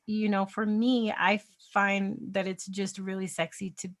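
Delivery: normal talking voice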